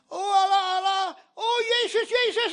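crying servant. Retold or imitated. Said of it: "En zij begon te huilen".

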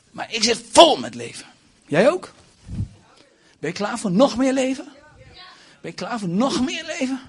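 high, loud call to the crowd; rousing call. Real, not acted. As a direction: fire up the congregation with high energy. Rousing the congregation. (opzwepend, hoog en luid)

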